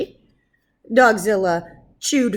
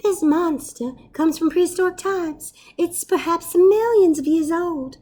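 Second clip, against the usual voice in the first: lightly voiced professor; 9 semitones higher, 4 dB louder than usual. Professor explains monster.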